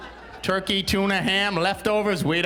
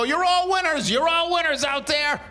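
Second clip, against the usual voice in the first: fake cheerful coach; 10 semitones higher than usual. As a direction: pump up the kids with forced cheer. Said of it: "you have to go".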